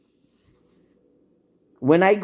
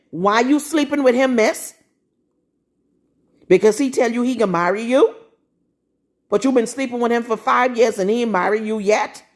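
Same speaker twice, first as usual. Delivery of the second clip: indignant questioning; 5 semitones higher than usual. Real, not acted. Confronting women in sermon.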